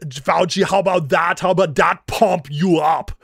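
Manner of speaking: hammed-up bodybuilder accent, taunting; Arnold-style taunt. Host as Arnold taunting Fauci after breaking him, hammed-up accent. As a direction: growl the taunt like a muscleman.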